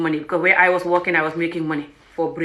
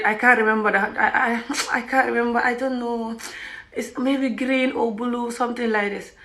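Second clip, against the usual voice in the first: small, soft, higher hesitant voice; mock timid uncertainty; imitating nervous hesitation; 4 semitones higher, 4 dB quieter than usual. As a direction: stall meekly, slowing down as you grope for an answer. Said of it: "I'm nervous. I'm scared".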